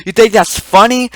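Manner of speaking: loud, high mock challenge; imitated angry teacher. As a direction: demand an answer as the offended teacher. Teacher repeats the accusation.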